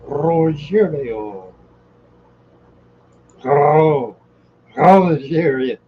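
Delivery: drawn-out, odd-sounding name calls; talking funny. Pretend. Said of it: "acting like I'm talking funny".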